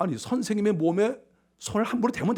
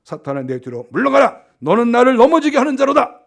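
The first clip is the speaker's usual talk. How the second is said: stern rebuke; 2 semitones higher, 7 dB louder than usual. Acted. The Lord rebukes Peter, sternly and loudly. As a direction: start firm, then ring out in reproof.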